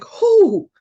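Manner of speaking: sleepy daughter perking up, eager; eager who. Retold or imitated. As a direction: jump awake at the news. Sleepy daughter perks up, voiced.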